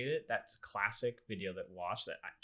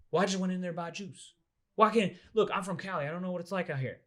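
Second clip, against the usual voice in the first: pleading customer; 9 semitones higher, 6 dB louder than usual. Retold or imitated. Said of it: "he just keeps explaining himself".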